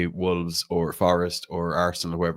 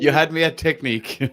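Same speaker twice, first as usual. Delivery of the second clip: laughing; 9 semitones higher, 4 dB louder than usual. Retold or imitated. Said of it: "Chris was like".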